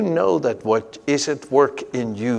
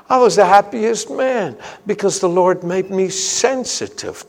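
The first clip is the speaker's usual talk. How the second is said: higher, louder, impassioned sermon voice; fervent preaching; 7 semitones higher, 4 dB louder than usual. Acted. Preacher stirring his congregation, fervent and loud. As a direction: preach it out with rising fire.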